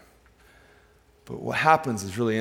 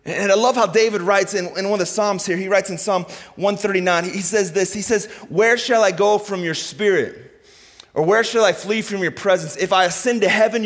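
raised preaching voice; impassioned psalm reading; 7 semitones higher, 5 dB louder than usual. Real, not acted. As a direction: proclaim the psalm to the congregation.

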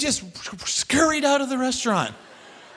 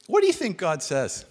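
ordinary delivery

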